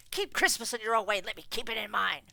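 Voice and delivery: loud, fast stern order. Acted. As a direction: lay down the law. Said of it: "returned the uncle sternly".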